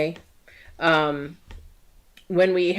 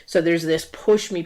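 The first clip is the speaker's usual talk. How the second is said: usual speaking voice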